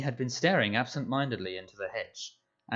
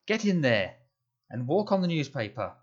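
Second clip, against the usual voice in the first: higher, louder bossy call; 5 semitones higher, 4 dB louder than usual. A narrator's reading. Aunt Petunia calling Harry inside from the garden, bossy. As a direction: order him in from a distance.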